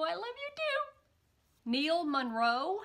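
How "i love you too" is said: high, slow and tender. Real, not acted. Tenderly answering a viewer who said I love you.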